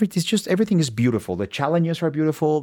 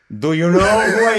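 mock celebrity indignation; 7 semitones higher, 7 dB louder than usual. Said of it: "I was about to go like".

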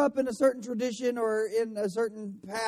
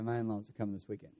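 much lower, much softer and fast, dreading the visit; 11 semitones lower, 12 dB quieter, much faster than usual. Shown as a demonstration. Preacher showing the other reading of the text message, dread. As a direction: mumble "My in-laws are coming this weekend" like bad news you'd rather not face. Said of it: "taken in two different ways".

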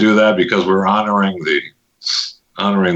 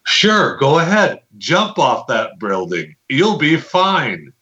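sarcastic; 7 semitones higher, slower than usual. Retold or imitated. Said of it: "it's like".

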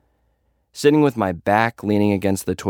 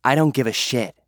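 scowling; 7 semitones higher than usual. Acted. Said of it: "Sweet says".

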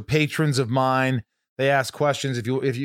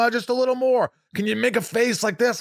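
higher-pitched mock goading voice; pitched-up, teasing imitation; mimicked crew request; 8 semitones higher than usual. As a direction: imitate a crew coaxing for more.

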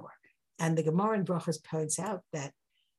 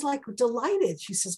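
ordinary talk

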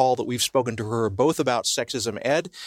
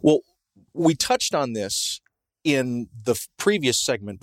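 ordinary talk, nothing marked

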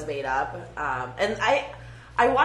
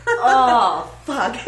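loud, higher repeated oh fuck; mock exclamation; 5 semitones higher, 8 dB louder, much slower than usual. Imitating a character reacting to porn, loud. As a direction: blurt it out over and over. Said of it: "the guy's just always like".

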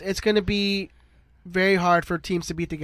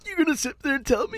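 higher, half-committed character voice; attempted impression; 7 semitones higher, 3 dB quieter than usual. Host trying out an impression for the panel, mock incredulous. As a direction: put on an incredulous voice, pitched up. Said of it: "You need the voice to be a little bit".

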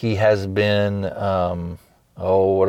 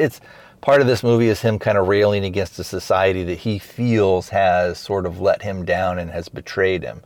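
normal talking voice

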